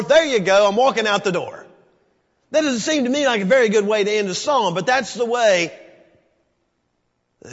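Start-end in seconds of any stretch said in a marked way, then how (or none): 0.0-5.8 loud, high preaching cry; fired-up preacher; full-force preaching. Acted. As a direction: preach it to the back row.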